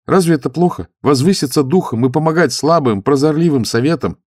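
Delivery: high, loud zealous youth; eager young monk. Acted. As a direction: lift the voice, earnest and keen. Youth voiced in story.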